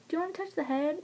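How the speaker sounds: soft high coaxing question; gentle nurse quote. Retold or imitated. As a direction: coax like a gentle midwife. Quoting staff in labor videos.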